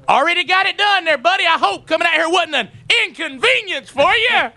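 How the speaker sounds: very high-pitched mock concern; sarcastic rant. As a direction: taunt him with exaggerated, high-voiced sympathy. Sarcastic rant at late installer.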